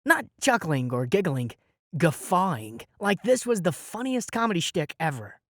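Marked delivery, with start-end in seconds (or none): none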